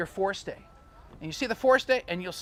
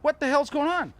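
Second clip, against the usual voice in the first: higher, startled quoted shout; crew alarm; 8 semitones higher, 4 dB louder than usual. Retold or imitated. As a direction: sound like the crew just noticed nobody steering. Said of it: "they realized no one was steering".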